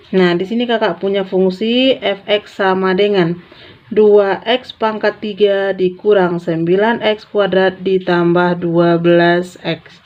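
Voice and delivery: ordinary delivery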